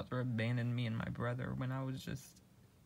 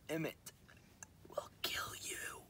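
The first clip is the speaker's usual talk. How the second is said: creepy whisper; 6 semitones higher, 6 dB quieter than usual. Acted. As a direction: breathe the warning like a ghost. Ominous warning in roleplay.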